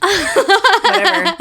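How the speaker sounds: laughing it off, high at first. Retold or imitated. Mom laughing off her son's five yogurts, amused. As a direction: giggle through a relieved brush-off.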